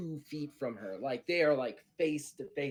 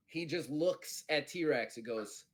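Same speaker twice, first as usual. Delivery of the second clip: normal talking voice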